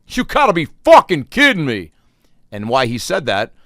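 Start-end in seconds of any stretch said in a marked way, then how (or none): 0.1-1.9 high, loud disbelieving outburst; angry outburst. Retold or imitated. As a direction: blow up like the guy told they're out of blizzards. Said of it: "I hear a guy in front of me say".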